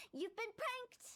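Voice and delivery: spring the surprise on him; gleeful prank reveal to the client. Acted.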